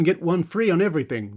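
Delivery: ordinary talk, nothing marked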